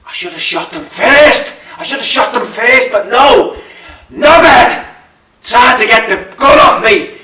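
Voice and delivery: high, loud, slow enraged shouting; furious rant. Acted. Gunman raging that he should have shot them, furious rant. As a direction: spit it out in rage. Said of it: "I thought".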